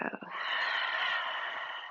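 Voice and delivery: long open-mouth sigh; cleansing exhale. Shown as a demonstration. Teacher showing how to release stale air, a long sigh. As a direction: let all the air out in one long, voiceless breath. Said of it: "let it go".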